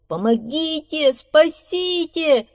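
high, loud, drawn-out cries; crying for help. Acted. Beaver dragged into the water by fish, screaming. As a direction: scream for rescue, stretching the words. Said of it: "закричал бобр".

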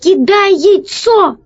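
high, slow, urgent call; shout. A narrator's reading. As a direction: call out the order, stretching the words. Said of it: "крикнул Пашка". (протяжный высокий крик)

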